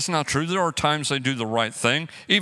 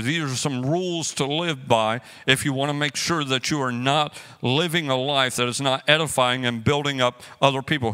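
ordinary talk, nothing marked

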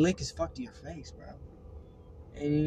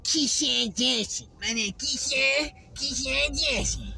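high, very slow put-on voice, stretching each word; Keyshawn Johnson impression; 9 semitones higher, much slower than usual. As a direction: drawl the name out slowly and high like Keyshawn. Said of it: "explain to him how Keyshawn Johnson sounds".

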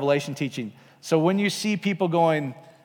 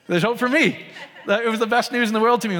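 laughing; 6 semitones higher than usual. Real, not acted. Joyful realization with laughter.

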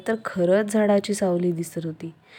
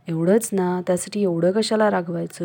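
usual speaking voice

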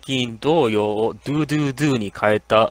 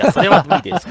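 fast, laughing through the words; 9 semitones higher, 6 dB louder than usual. Real, not acted. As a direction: laugh it out quickly. Laughing at the question.

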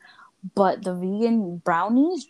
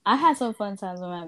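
ordinary delivery

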